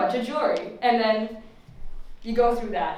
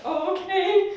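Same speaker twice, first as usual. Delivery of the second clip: meek surprise; 8 semitones higher, slower than usual. Retold or imitated. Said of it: "I was like".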